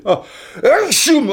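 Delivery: real loud sneeze; ah-choo, a real sneeze; sneezing. Real, not acted. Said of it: "je vais éternuer".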